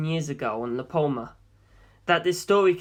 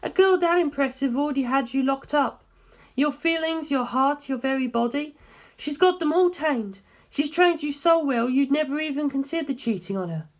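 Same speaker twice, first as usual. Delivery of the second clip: put-on young woman, higher than the narration, steady and serious; Darlington's voice; 9 semitones higher than usual. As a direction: lay out the findings calmly, like a briefing. Darlington teasing George.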